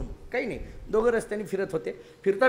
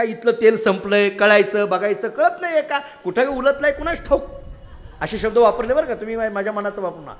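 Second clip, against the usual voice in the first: ordinary talk, nothing marked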